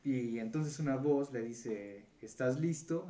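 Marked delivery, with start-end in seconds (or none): 2.2-3.1 a looming voice from beyond the gates, raised and higher; lightly voiced booming demon asking if he's ready; big Satan voice. The voice greets the dead man at hell's gates, lightly booming. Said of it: "Así una voz bastante, pues, grande".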